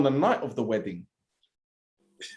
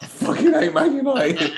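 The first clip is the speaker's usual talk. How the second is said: high, slow mock complaint; imitating Jan; 11 semitones higher, 4 dB louder than usual. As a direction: whine it slowly as a pitched-up impersonation. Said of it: "and you ask him".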